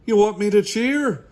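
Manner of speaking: loud, high yelled question; boisterous high-pitched shout; yelling. A narrator's reading. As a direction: yell it over engine noise. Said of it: "yells Bean".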